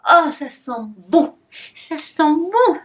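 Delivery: savouring. Real, not acted. Smelling the candle.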